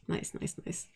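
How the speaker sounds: soft unvoiced muttering under breath; whispered mutter. Real, not acted. Mishap just happened, muttered.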